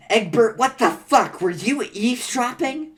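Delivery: loud, high-pitched angry accusation; indignant outburst. Acted. Catching Egbert eavesdropping, outraged and loud. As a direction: round on a snoop.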